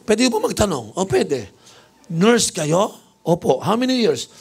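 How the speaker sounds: high, playful setup; teasing questions. Retold or imitated. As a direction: lead her on, voice up high. Bishop teases nurse with questions. (pabirong tanong, mataas ang boses)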